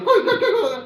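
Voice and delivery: very high mock dog yelps; kicked dog yelping. Speaker likens crying rival to kicked dog, mocking high yelps. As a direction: yelp like a dog that has been kicked. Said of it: "कुत्ते को लात मारा तो रोता नहीं".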